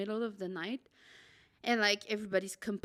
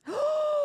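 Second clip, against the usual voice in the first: sharp gasp; 6 dB louder than usual. Retold or imitated. Seeing rats on the floor.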